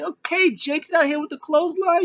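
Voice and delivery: squeaky high voice, thrilled; excited high squeal. Retold or imitated. Fan squealing at the wrestler's entrance, high and excited. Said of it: "I was like".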